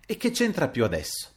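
dazed and moved. Acted. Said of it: "intontito e commosso".

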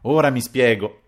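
a hint of vexation; annoyed. A narrator's reading. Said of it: "disse contrariato". (contrariato, con tono seccato)

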